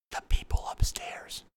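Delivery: hushed secret whisper. Real, not acted. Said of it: "I gotta kinda keep this down".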